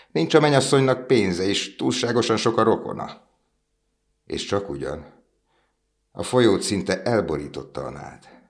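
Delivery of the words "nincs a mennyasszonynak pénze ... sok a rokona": much higher, louder bird gossip; twittering swallows. A narrator's reading. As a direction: sneer like chattering birds. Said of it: "csivitelték a többi fecskék".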